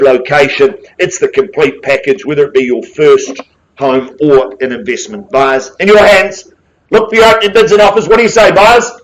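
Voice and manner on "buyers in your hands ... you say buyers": raised, higher auctioneer's call, quickening; rally the room for opening bids; auction calling. Real, not acted. Auctioneer urging buyers to open bidding, raised auction call.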